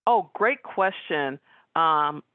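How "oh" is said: usual speaking voice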